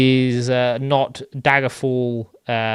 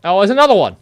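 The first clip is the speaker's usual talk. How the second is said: surprised; 7 semitones higher, 12 dB louder, much faster than usual. Real, not acted. Spots another enemy, excited.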